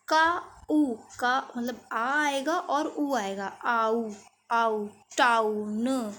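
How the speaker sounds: आ उ split slowly into parts; sound it out. Shown as a demonstration. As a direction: sound out टाउन as आ plus उ. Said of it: "डब्ल्यू ओ और डब्ल्यू एक साथ आता है".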